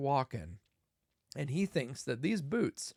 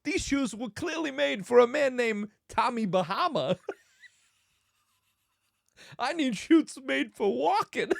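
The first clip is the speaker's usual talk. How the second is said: high, loud, halting complaint; hammed-up celebrity impression, emphatic and put out; Christopher Walken; 9 semitones higher, 5 dB louder than usual. Movie star demanding proper shoes, hammed-up impression. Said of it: "he's like".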